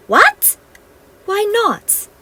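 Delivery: high, loud indignant What; shocked. Acted. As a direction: protest in disbelief. Fairies shocked.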